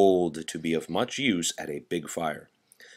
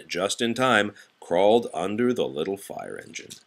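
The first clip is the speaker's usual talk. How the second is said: plain reading voice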